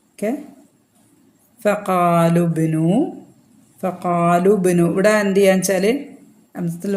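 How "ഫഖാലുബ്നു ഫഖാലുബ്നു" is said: ഫഖാലുബ്നു with the wāw and hamza dropped, slow; silent wāw. Shown as a demonstration. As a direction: glide straight from ഖാ into ലുബ്നു. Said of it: "pronounce ചെയ്യൂല". (ഫഖാലുബ്നു എന്ന് വാവും ഹംസയും ഉച്ചരിക്കാതെ)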